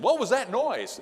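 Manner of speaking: a loud barging yell of "What was that noise?"; loud demand. Retold or imitated. Father bursting into the kids' room, loud yell. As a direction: burst in calling out loudly.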